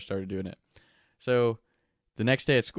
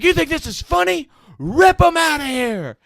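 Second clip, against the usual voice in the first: furious yell; 11 dB louder than usual. Retold or imitated. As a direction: roar it at the laughing kid. Principal yelling again.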